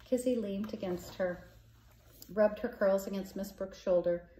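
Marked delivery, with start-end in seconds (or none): none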